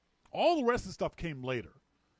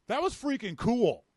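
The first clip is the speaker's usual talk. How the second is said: higher and louder, delighted; thrilled; 7 semitones higher, 6 dB louder than usual. Retold or imitated. Walking away from the stranger, thrilled and pleased. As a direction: savour the little triumph out loud.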